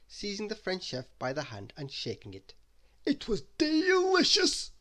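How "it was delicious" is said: high, slow, louder greedy voice; Mister Greedy, shrugging off a cake, in a high slow voice; greedy character voice. Acted.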